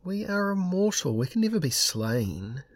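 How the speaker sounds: high-pitched, faintly impatient retort; insist, a little impatiently; tinge of impatience. A narrator's reading. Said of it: "there was a tinge of impatience".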